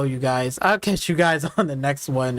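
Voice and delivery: ordinary talk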